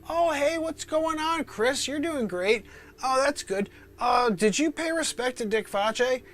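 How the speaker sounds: high, cheery gatekeeper greeting; lightly voiced jolly Saint Peter welcoming you; a high friendly porter at heaven's gate.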